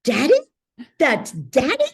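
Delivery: put-on high, loud little girl's cry of recognition; little girl surprised. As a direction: voice the small daughter realizing it's her dad. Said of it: "she hears his voice and she goes".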